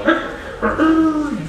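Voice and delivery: high crowing rooster cry; imitating rooster crow. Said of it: "wake up at, like, three o'clock in the morning and go".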